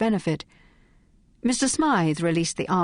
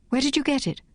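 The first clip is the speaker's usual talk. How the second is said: sharp; 8 semitones higher, faster than usual. Acted. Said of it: "asked Phryne sharply".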